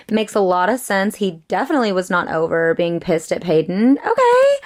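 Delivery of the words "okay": high squeaky okay of shock; shocked high-pitched okay. Real, not acted. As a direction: squeal it out, stunned. Shocked reaction to plot twist.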